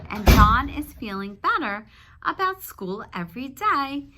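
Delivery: projected read-aloud storytelling; reading to children. Acted. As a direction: read it out to the class.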